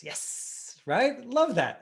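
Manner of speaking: high, loud delight; light up with real delight; excited. Real, not acted. Teacher thrilled by a student's chat comment, excited.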